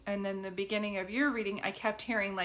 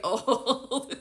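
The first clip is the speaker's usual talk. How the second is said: words broken by laughter; real giggles while speaking; laughing; 3 dB louder than usual. Real, not acted. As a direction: laugh through it slowly. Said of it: "on a roll today".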